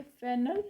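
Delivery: फेनल said as a careful model word; demo pronunciation. Shown as a demonstration. Teacher modelling फेनल for students, carefully. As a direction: say फेनल clearly once for learners to copy. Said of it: "फेनल इस तरह से pronounce किया जाता है".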